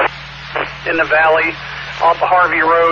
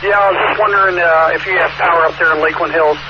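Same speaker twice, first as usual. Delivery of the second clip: tinny radio transmission voice; over walkie talkie; 8 semitones higher, 4 dB louder than usual. Real, not acted. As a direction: sound like it's coming through a handheld radio. Caller heard over ham radio.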